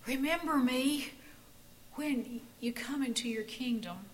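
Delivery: a dying man's last breath, fading to a whisper; breathless gasp. A narrator's reading. Joachim gasping his plea to Jesus, fading to a whisper. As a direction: squeeze the words out with no air left. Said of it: "he gasped".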